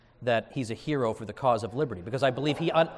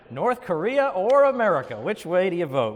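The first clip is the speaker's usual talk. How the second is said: higher, louder, hammed-up dilemma; mock-dramatic question; 4 semitones higher, 5 dB louder than usual. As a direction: pose it like a momentous choice, tongue in cheek. Mockingly restates opponent's stark choice.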